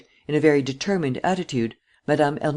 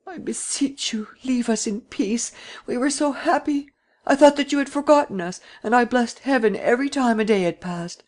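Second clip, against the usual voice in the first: put-on imploring old lady; 9 semitones higher than usual. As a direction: beg him tearfully to leave them in peace. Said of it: "implored him".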